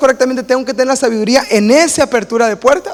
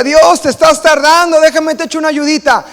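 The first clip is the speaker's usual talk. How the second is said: impatient with God; 7 semitones higher, 4 dB louder than usual. Acted. Believer tired of waiting tells God he'll help, exasperated. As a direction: nudge God along, mock exasperated. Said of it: "y aunque diga".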